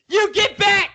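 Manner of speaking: high, loud yell to get back; shouting. Retold or imitated. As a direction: scream at the intruder in the yard. Acted owner yelling at intruder.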